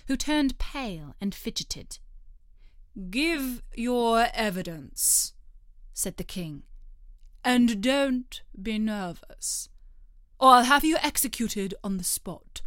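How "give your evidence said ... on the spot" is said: threatening king. Acted. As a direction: menace him like a pompous king.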